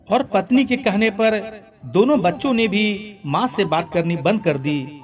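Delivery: usual speaking voice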